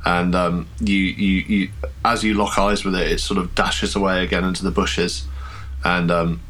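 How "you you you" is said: normal talking voice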